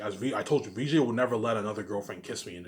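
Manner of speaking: ordinary talk, nothing marked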